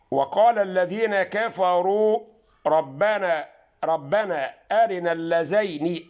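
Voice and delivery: Quran recitation. Shown as a demonstration. Teacher recites Fussilat verse to viewers, slow chant. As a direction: chant the verse out, drawn long.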